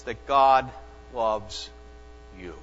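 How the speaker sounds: God loves you split word by word with pauses; slow, spaced declaration. Acted. Preacher first stating the key truth, slow and spaced. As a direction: give each word its own beat. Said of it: "Please hear that".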